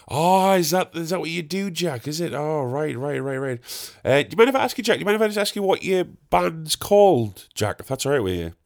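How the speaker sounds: high-pitched imitation of a chatty labourer; nosy gardener. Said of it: "replaced all the mates with Jack".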